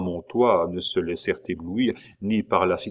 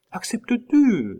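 a far higher, eager Claude echoing "Acceptes-tu?"; Claude asking; 4 dB louder than usual. A narrator's reading. Claude echoes the question to Pitou, much higher and eager. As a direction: echo the question up high, eager. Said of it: "demanda Claude".

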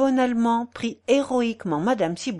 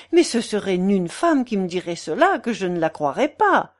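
incredulous portress; 5 semitones higher, 6 dB louder than usual. Acted. Portress refusing to believe the old bachelor, incredulous. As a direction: scoff with voluble disbelief.

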